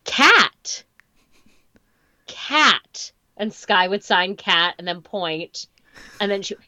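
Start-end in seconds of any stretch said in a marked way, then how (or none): 0.0-3.1 high, exaggerated "cat"; therapist modeling. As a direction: overenunciate like a speech therapist. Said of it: "the speech therapist would go".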